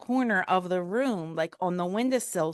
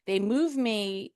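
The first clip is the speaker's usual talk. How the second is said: ordinary delivery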